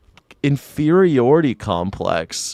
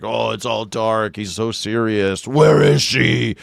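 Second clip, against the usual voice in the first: slow, mock grim superhero voice, ending louder; mock Batman growl; 3 semitones lower, 3 dB louder, slower than usual. Mocking Nolan's Batman, slow, growling "Where is she?". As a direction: drag it out like a brooding caped hero. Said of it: "Everything's like".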